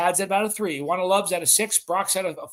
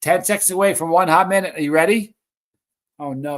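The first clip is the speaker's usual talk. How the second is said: ordinary talk, nothing marked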